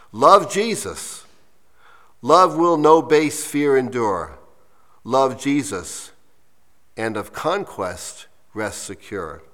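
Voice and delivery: measured, emphatic close of a poem; slow reading. Real, not acted. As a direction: read it slowly, pausing after each phrase. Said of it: "read it to you slowly".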